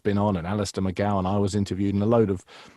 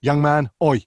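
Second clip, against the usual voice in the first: a touch louder mock chef, bouncing; punchy mock Gordon Ramsay calls; Gordon Ramsay energy; 4 semitones higher, 4 dB louder than usual. Shown as a demonstration. Impressionist demoing the chef's bounce, lightly voiced louder Gordon Ramsay. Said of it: "bouncing up and down on your feet".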